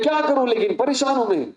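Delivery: high, whiny complaint; exasperated plea. Acted. A sister complaining to her brother about her mother-in-law, whiny. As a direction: plead that you're fed up.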